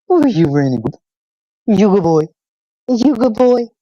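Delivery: high, excited praise for a dog; doting pet talk. Real, not acted.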